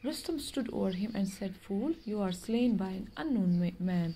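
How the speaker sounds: slow, measured reading of a tale; story reading. Acted. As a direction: read each word out slowly for the class. Teacher reads story aloud. (धीमी आवाज़ में कहानी पढ़ना)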